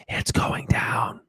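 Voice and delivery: slow breathy whisper, imitating Joe Biden; Joe Biden impression. Doing Joe Biden for his viewers, slow whisper. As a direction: hush it out slowly like a secret. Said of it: "he says the most important things in a low tone voice".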